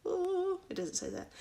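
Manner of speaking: ordinary delivery, nothing marked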